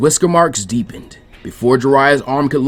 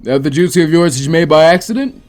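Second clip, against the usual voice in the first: a touch higher, louder gruff query; questioning; 5 semitones higher, 6 dB louder than usual. Acted. Jiraiya questioning Naruto, lightly voiced gruff. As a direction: probe him with a hint of a mentor's voice. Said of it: "he questioned".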